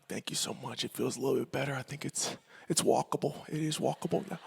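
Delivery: very soft, low and small; hushed little thanks, as if recovering; meek, soothed kid. Retold or imitated. Kid thanking mom for kissing the knee, meek and hushed.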